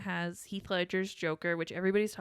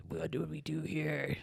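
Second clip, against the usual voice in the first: imitating Batman voice; 3 semitones lower, 3 dB quieter than usual. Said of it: "the Batman voice".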